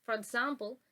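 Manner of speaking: ordinary talk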